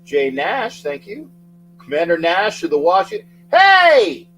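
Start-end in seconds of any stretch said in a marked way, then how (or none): 3.5-4.3 high, loud greeting shout; loud hey. Real, not acted. Host cheers a viewer's arrival, loud shout. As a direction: throw a big hey at him.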